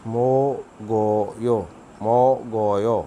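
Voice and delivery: मो गो यो said slowly syllable by syllable; Korean pronunciation demo. Shown as a demonstration. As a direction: spell out मो गो यो in Korean sounds. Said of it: "ये pronounce करते हुए ऐसे पढ़ना है". (कोरियन उच्चारण में धीरे से)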